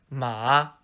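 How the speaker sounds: "ma" dipping low then rising back up, Mandarin third tone. Shown as a demonstration. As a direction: drop "ma" low and let it curl up. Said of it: "third tone goes down and then back up".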